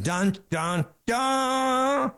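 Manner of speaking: high, mock-dramatic sting sung out; hammed-up doom jingle; ominous dun dun. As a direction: hum a cheesy horror sting. Mocking doom prophecies.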